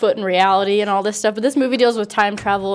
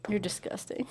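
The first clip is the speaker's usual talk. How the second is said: whispering; 14 dB quieter than usual. Real, not acted. Teasing about slurping.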